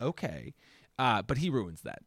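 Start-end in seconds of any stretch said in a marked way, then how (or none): 0.1-0.5 resigned okay. Retold or imitated. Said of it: "it's more of a".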